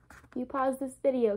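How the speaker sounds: usual speaking voice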